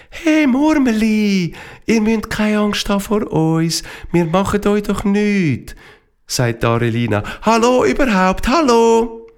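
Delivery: calm the frightened marmots; reassuring girl. Acted. Arelina reassuring the marmots, high and gentle.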